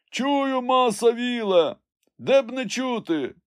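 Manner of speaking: high, drawn-out answering call; calling back. A narrator's reading. Climber assures his master he hears, high call. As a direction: holler the reply down from above. Jupiter calls back from the tree.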